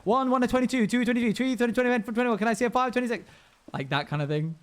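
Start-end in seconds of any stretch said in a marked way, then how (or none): 0.0-3.2 high, loud rapid auction chant; auctioneer voice. Retold or imitated. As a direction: rattle off bids like an auctioneer. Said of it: "You sound like an auctioneer".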